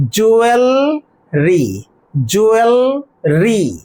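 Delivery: জুয়েলরি slowly in two parts, juel, ri; corrected pronunciation. Shown as a demonstration. Teacher correcting the pronunciation for students, slow corrected form. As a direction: hold juel, pause, then ri in জুয়েলরি. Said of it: "প্রনাউন্সিয়েশনটা আমরা correct করতে পারবো".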